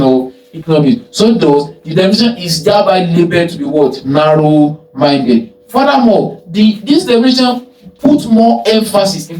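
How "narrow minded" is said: drawn-out, deliberate "narrow-minded"; slow emphatic answer. Shown as a demonstration. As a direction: stretch out "narrow-minded" slowly, as the key term. Teacher stresses answer to own question.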